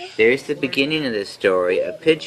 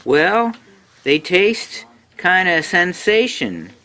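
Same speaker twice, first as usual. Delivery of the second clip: high-pitched, gushing storybook pigeon; gushing praise; 9 semitones higher than usual. A narrator's reading. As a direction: rave about it, pitched well up. Pigeon raves about hot dogs.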